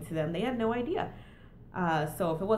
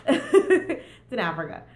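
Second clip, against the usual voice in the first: laughing; 3 semitones higher, 8 dB louder, slower than usual. Real, not acted. Laughing at clueless askers.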